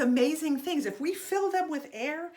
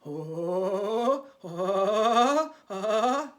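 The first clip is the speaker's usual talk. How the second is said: ghost noises; 4 semitones lower, 3 dB louder, much slower than usual. Shown as a demonstration. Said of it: "making ghost noises".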